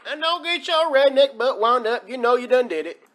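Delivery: normal talking voice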